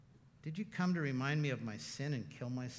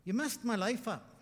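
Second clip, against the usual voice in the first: retold complaint; 7 semitones higher than usual. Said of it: "when I just said".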